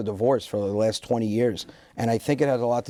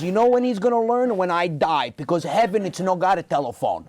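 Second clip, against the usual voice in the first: put-on higher Italian-accented guilt line; Italian father playing the martyr; father guilt-tripping about dying, Italian accent; dramatic Italian dad; 6 semitones higher than usual. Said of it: "with that accent".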